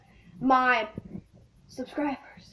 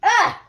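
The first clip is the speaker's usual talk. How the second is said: disgusted yelp; 5 semitones higher, 7 dB louder than usual. Real, not acted. Reacting to skunk spray taste.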